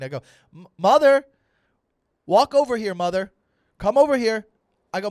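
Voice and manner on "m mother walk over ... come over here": high, shouted summons to Mother; bossy teen. Retold or imitated. Fourteen-year-old summoning his mother, loud and bossy. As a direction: shout orders like an imperious kid. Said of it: "that's how I spoke to my mom".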